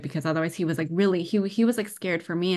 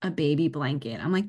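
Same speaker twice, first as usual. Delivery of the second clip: ordinary talk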